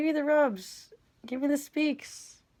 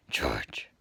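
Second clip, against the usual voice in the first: low breathy whisper of the name; whispered call; 6 dB quieter than usual. Real, not acted. Owner whispering the cat's name to him, soft whisper.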